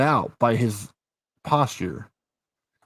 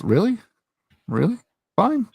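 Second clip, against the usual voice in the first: higher, rising really, really, then a high fine; fed up and giving in; mock exasperated; 11 semitones higher than usual. Imitating the husband answering his wife's mocking, exasperated. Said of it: "he looked back at her and goes".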